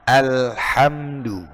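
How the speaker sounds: Alhamdu very slow, open plain a; slow correct alhamdu. Shown as a demonstration. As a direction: stretch Alhamdu with a clear a. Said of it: "A, tetap a". (alhamdu pelan dan benar)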